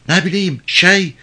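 high-pitched flustered reply; high, panicky helplessness; flustered villager. Acted. Fleeing villager answers the cart driver, high and flustered.

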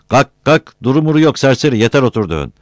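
high, loud bossy order; impatient scolding. A narrator's reading. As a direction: scold a loafer onto his feet. Impatient order in acted quarrel.

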